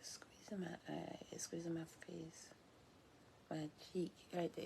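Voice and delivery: unvoiced whisper to herself. Real, not acted. Muttering to herself mid-reading in a whisper. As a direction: breathe it out under her breath. Aside while touching face.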